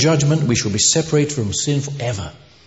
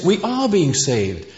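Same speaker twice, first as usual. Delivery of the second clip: "are" raised high and drawn out; stress on ARE; 5 semitones higher, slower than usual. Shown as a demonstration. Preacher quoting scripture on salvation's tenses, stressing "are". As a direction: punch "are" slowly to mark the present tense.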